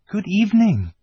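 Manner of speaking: high-voiced little girl's greeting; Lucy's greeting. A narrator's reading. Lucy greets the fawn, high girl's voice. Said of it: "said Lucy".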